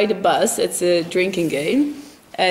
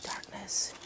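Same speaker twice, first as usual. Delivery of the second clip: hushed, very soft whisper; 19 dB quieter than usual. Pretend. Vlogger at the unlit bonfire place, whispering. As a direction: whisper it under the breath. Playful lead-in to a song.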